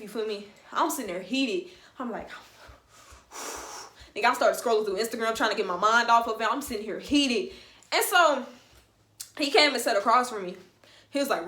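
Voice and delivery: plain reading voice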